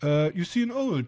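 normal talking voice

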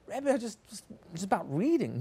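a shy pupil brushing off the praise; child's voice. Acted. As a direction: pupil to his rebbe, shyly downplaying his reading. Pupil answers his rebbe.

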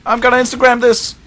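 high, loud, fast shout; frantic high-pitched holler; excited yell. Pretend. Yelling excitedly while grabbing camera.

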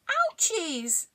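high whimpering ouch; crying out in pain. Acted. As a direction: cry it out over a sore paw. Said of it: "cried Lily".

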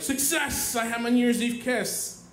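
high-pitched mock triumph; triumphant. Celebrating his New Year's Eve kiss, triumphant.

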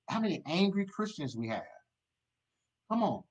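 ordinary talk

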